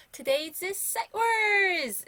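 slow, high, drawn-out announcement; announcer voice. Shown as a demonstration. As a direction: call it out like a show title. Announcing the day's words. (천천히 높게 외치는 안내 목소리)

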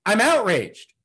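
loud, high shout of anger; angry proclamation. Acted. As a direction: shout it out of an open window. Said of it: "open up our window and say".

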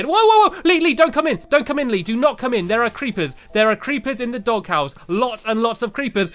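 loud, high alarmed calling out; urgent warning. Real, not acted. Warning Lee not to enter the creeper-filled doghouse, loud alarmed shouting. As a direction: shout a warning to keep him out.

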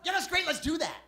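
high, loud fake enthusiasm; gushing Los Angeles no. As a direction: agree eagerly while meaning no. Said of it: "impression of someone from, from Los Angeles saying no".

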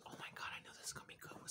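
quick, hushed aside; excited whisper. Real, not acted. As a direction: whisper it fast with anticipation. Eager aside before tasting.

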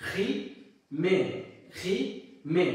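খি মে said syllable by syllable, slow and clear; slow syllable demo. Shown as a demonstration. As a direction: sound out খি মে slowly for the class. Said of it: "উচ্চারণ হলো খি মে". (খি মে ধীরে ধীরে স্পষ্ট করে উচ্চারণ)

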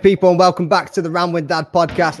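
loud, upbeat host welcome; podcast intro. Acted. Host greeting listeners at the start of his podcast, loud and upbeat. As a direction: open the show big and bright.

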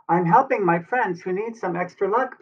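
high, pleading cat character; earnest Pete voice. Acted. Pete voiced, explaining to Clover.

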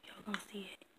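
soft unvoiced whisper. Real, not acted. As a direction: whisper it like a secret. Drops to secret aside.

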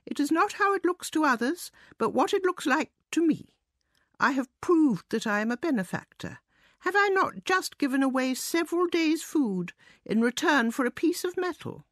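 high-pitched mouse reasoning with himself; self-important mouse. A narrator's reading. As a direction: reassure yourself in a high little mouse voice.

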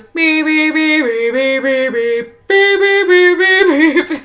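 high loud beeping ringtone; imitated phone beep. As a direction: mimic the phone beeping its tune. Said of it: "It goes".

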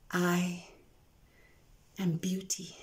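soft unvoiced whisper. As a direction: confide it like a secret. Poem, whispered reveal.

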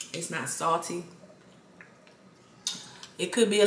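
eating between words; talking while chewing. Real, not acted. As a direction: mouth partly full. Seen 2: spoons mussel in at 2.1, chews with closed lips through 7.0.

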